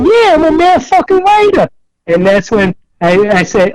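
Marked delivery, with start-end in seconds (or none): 0.1-1.7 much higher, hyped declaration; proud boast. Retold or imitated. Telling his friends his crew name, hyped and high. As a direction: shout your new name with pride.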